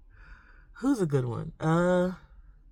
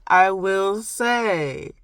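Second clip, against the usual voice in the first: stretched, slow "I will say", louder and higher; slow, playful proclamation; drawn-out emphasis; 4 semitones higher, 6 dB louder, much slower than usual. Real, not acted. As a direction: draw out "I will say" after a laugh. Playful drawn-out repeat after laughing.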